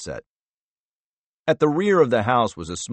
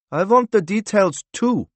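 put-on high, louder accented interrupting voice; new character interjecting, high and louder; another voice; 11 semitones higher, 5 dB louder than usual. A narrator's reading. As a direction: cut in with pitch raised well up. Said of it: "said another voice".

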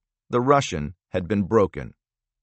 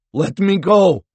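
higher, louder panicked plea; voice cracking; 10 semitones higher, 8 dB louder, slower than usual. Acted. Prisoner begging the interrogator to release him, cracking with fear. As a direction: beg to be let go, voice breaking with fear. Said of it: "His voice cracked".

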